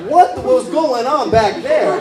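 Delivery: high, loud, slow wonder; slow, loud, amazed asking; mock curious. His own mock-curious quote.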